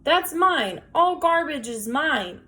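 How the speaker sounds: high, slow possessive yell; king of the garbage shouting; shouted. A narrator's reading. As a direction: bellow it like a madman guarding his trash. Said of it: "Ernest shouted".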